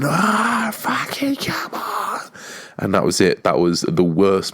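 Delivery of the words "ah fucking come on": much higher, strained mock scream fading to a breathy end; retold celebratory scream. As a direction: relive the goal with a high cry that runs out of breath.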